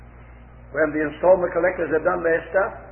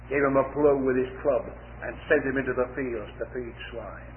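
ordinary talk, nothing marked

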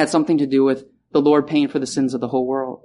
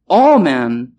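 "All men" punched loud; loud, emphatic "All men"; stress on all; 7 dB louder than usual. Shown as a demonstration. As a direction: hit "All" hard. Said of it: "really drive this home".

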